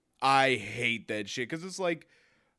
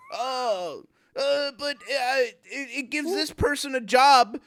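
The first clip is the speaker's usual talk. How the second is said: high, hesitant mock excuse; whiny mock voice; 11 semitones higher, 5 dB louder than usual. Mocking a customer's excuse, high and whiny. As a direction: parody a feeble excuse.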